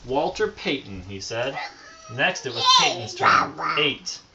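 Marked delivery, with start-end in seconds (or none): none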